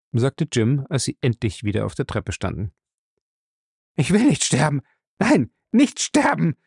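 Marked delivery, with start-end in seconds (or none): 4.0-6.6 terrified. Acted. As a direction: beg for life in terror. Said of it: "vor Todesangst wahnsinnige".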